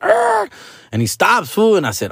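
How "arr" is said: very high mouthed brake squeal; imitated tire screech. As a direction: screech like braking tires.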